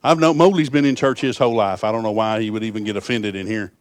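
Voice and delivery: normal talking voice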